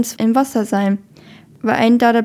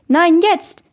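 loud, higher, insistent snap; angry shout; 7 semitones higher, 5 dB louder than usual. A narrator's reading. Lifeguard to stubborn swimmer, angry shout. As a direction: demand it this instant. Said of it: "rief das Walross wütend".

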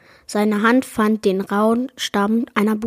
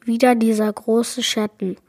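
usual speaking voice

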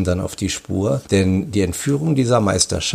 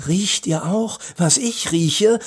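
delighted sniffing; 8 semitones higher than usual. A narrator's reading. Boy smells cake in the air, delighted. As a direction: draw it out high with delight. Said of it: "schnupperte entzückt in der Luft".

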